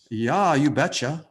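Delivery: drawn-out Minnesota "you betcha"; Minnesota accent. Retold or imitated. Teacher answering his own question in a jokey Minnesota accent. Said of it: "that's my imitation of my Minnesota accent".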